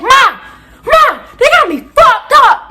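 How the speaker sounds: very high, loud cry for mom; dramatic outburst. Retold or imitated. Student on phone to mom, high dramatic shouting. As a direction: holler for mom, stretching the last words. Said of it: "I pulled a whole Camilla type shit".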